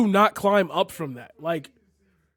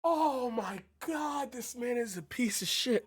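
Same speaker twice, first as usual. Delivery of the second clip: hushed and aghast, pitched higher at the start; stunned disbelief; 5 semitones higher, 9 dB quieter than usual. Real, not acted. Viewer reacting to a radio host's clips, stunned and hushed.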